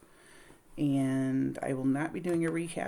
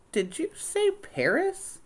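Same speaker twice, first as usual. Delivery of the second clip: high, slow perked-up question; suddenly interested; slower than usual. Acted. Nellie perking up at Paris, high and slow. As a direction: let the word Paris hook her.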